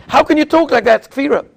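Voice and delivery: acted-out angry objection; angry rebuke. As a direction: voice an indignant challenge, mildly heated. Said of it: "he gets angry".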